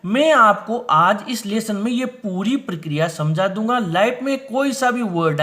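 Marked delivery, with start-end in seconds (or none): none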